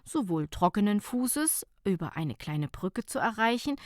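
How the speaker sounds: ordinary delivery, nothing marked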